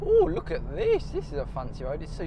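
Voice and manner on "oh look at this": surprised delight. Real, not acted. Delighted at the smooth road.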